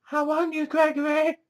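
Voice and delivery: very high, thin-volume put-on female voice; Morgana. Djinn luring Gregory in her voice, imitated Morgana. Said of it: "he's getting the voice of Morgana through".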